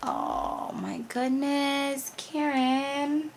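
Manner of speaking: soft, slow, high and touched; slow hushed gratitude; moved, emotional. Real, not acted. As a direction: say it softly, close to emotional. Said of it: "I'm not gonna get emotional".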